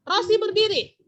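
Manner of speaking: "Rosi berdiri" as a loud order, pitched high then falling; command intonation. Shown as a demonstration. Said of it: "Mem lagi sebutkan kalimat perintah". ("Rosi berdiri" dengan nada perintah yang lantang)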